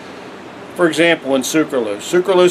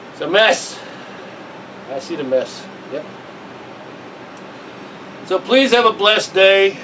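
ordinary talk

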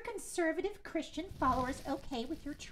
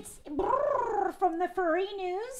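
cat voice; 7 semitones higher, slower than usual. Acted. Furry caller in cat voice.

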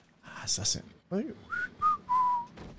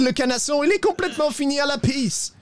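worked-up lament; 11 semitones higher than usual. Acted. Rider showing his horse is finished, high worked-up lament.

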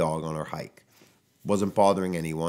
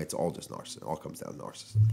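hushed aside; 6 semitones lower, 8 dB quieter, faster than usual. Acted. Repeating narcissism, hushed aside.

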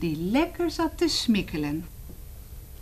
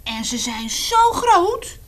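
put-on high, loud, slow boy voice; dramatic boy; 9 semitones higher, 6 dB louder, slower than usual. Reading to children, the boy describes the giant beasts. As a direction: make it sound huge. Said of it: "zei het jongetje".

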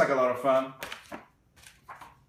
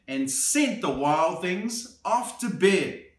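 lively picture-book read-aloud; storybook narration for a little one; reading to children; 7 semitones higher than usual. A narrator's reading. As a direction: read it like bedtime story time.

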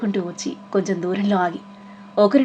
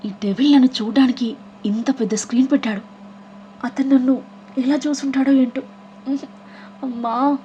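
pained groan; 5 semitones higher than usual. Acted. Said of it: "బాధగా మూలిగింది".

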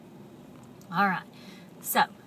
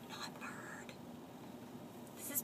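whispered aside; 20 dB quieter than usual. Real, not acted. Playful whispered aside to kids.

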